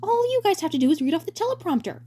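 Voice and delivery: bright high showman voice; high cheery director voice. Acted. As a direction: play the grinning director, way up high.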